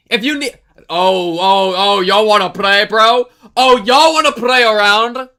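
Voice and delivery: taunting. Real, not acted. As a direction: dare the chat to play around. Taunting chat loudly.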